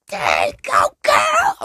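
put-on "girl" shouted way up high; hype shout. Friend hyping up a girl, ending in a high shout. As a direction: cheer her on with a yelp.

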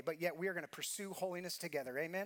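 ordinary delivery

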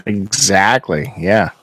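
ordinary talk, nothing marked

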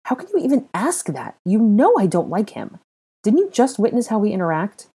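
high, loud indignant protest; affronted and incredulous; offended. A narrator's reading. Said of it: "terribly offended by the accusation".

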